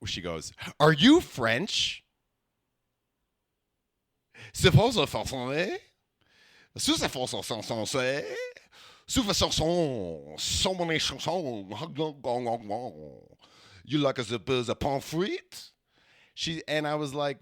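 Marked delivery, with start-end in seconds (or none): 0.8-2.0 loud, drawn-out imitation of a Quebec woman; mock French-Canadian question, slow and loud; Quebecois woman. Quebec woman on the dance floor, loudly imitated French-Canadian accent. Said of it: "she's from Quebec". 4.5-15.7 very drawn-out fake Quebec French gibberish; mock Quebecois French. Quebec woman questioning him on the dance floor, mock French accent. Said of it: "she's from Quebec".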